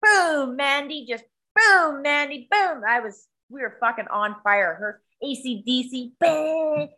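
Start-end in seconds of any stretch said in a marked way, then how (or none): none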